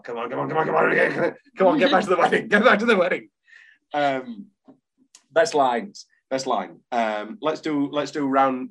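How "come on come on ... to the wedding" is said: mildly exasperated, repeated urging, getting louder; impatient urging. Acted. Film viewer to the characters on screen, impatient urging. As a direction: hurry them along with building impatience.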